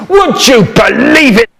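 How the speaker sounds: hammed-up shouted repeat; loud shout. Shown as a demonstration. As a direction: blast the question out the second time. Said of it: "repeat, second louder take".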